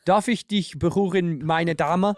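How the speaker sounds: slow, courtly German "Darf ich dich berühren, meine Dame"; German line. Shown as a demonstration. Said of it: "my lady, is".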